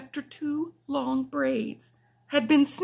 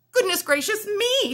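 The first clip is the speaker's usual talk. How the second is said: surprised; 10 semitones higher, 4 dB louder than usual. Acted. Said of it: "she said".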